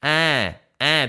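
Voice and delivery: bright whiny ah, loud; whiny twang. Shown as a demonstration. Coach demonstrating twang for students. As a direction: sing a twangy ah. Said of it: "this kind of whiny".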